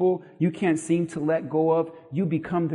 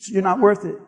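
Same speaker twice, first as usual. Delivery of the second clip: a flat, cool shrug of a line; dismissive brush-off; 4 dB louder than usual. Shown as a demonstration. Mock brushing off a gossip. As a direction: wave them off. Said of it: "go like this now".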